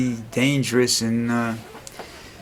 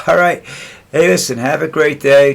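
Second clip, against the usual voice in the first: loud sign-off; 5 semitones higher, 8 dB louder than usual. Real, not acted.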